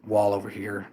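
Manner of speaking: normal talking voice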